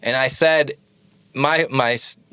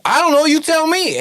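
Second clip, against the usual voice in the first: high, loud frantic squeal; retold high-pitched freakout; panicked retort; 4 dB louder than usual. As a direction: blurt it out in a mock panic, pitched way up. Panicked answer to roommate.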